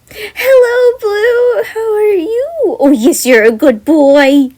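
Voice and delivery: very high, loud cooing pet voice; baby talk to pet. Acted. Jem greeting her bear Blue, high loud cooing baby talk. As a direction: coo at the bear like a puppy.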